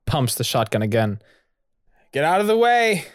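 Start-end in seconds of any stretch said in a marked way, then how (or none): 2.1-3.1 loud, high warning shout; calling out loudly. Acted. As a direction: yell at him to move aside. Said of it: "Raphael calls out to him".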